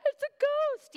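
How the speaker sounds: hammed-up panicked yelp, very high and loud; mock freak-out. Disciples spotting someone on the water, mock freak-out. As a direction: play disciples losing their minds in a high shriek. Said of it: "they freak out".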